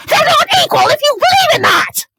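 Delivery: very high, loud scream; sudden shout. Real, not acted. Reviewer springing a shout on leaning-in viewers, sudden scream. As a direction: blast it in their ears.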